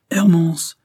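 high, loud summons; shouting. A narrator's reading. Priest summons his old servant, shouting. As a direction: holler the name across the house. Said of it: "Il cria".

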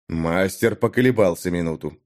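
ordinary delivery, nothing marked

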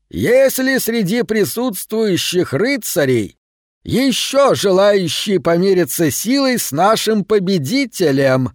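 slow, high royal call across the field; king calling out. A narrator's reading. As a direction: proclaim it to the whole arena. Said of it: "король крикнул".

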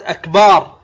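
"أكبار" with a long aa after the b; lengthened ba. Shown as a demonstration. Teacher showing what the word becomes, lengthened ba. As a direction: draw out the "baar" of "أكبار". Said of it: "فتجعل الكلمة صارت".

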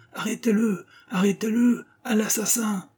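high-pitched cries at normal volume; narrated crowd raising the alarm; crying out. A narrator's reading. Residents chase the fugitive down the stairs, crying out. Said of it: "en criant".

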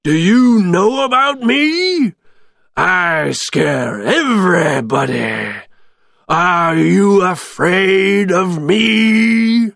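loud, drawn-out monster voice; stretched, swooping villain boast; menacing bogeyman. A narrator's reading. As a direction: intimidate your captive slowly. Oogie Boogie menaces captive Santa.

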